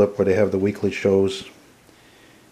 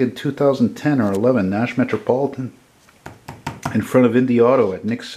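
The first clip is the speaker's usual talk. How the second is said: ordinary delivery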